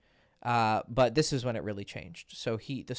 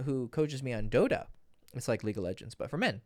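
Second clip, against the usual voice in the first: usual speaking voice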